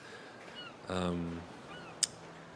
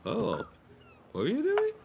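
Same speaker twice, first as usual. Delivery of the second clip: very high, rising nosy question; eager curious sailor. Acted. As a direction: lean in, dying to know. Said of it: "and goes".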